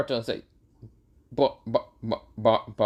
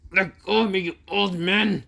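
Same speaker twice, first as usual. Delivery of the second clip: angry protest; 7 semitones higher than usual. Acted. Boruto yelling at his father to let go, angry. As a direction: push him off with words. Son struggles in father's grip.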